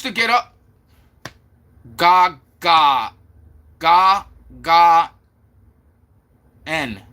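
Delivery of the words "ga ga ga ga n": "Ga, ga. Ga, ga. N." in separate sounds; syllable by syllable. Shown as a demonstration. Streamer reading out chat's spelling, "Ga, ga, N" piece by piece. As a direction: sound out "Ga, ga" then the letter "N". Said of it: "How do you go ga, ga, and then N".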